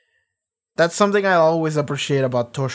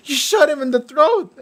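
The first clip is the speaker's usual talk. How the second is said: mock outrage; 12 semitones higher, 3 dB louder than usual. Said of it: "they're like".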